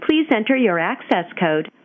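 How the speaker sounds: normal talking voice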